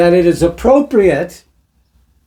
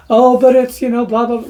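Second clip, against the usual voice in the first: higher-pitched dismissive mimicry; mocking objector; 7 semitones higher than usual. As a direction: parrot a naive objection in a higher voice.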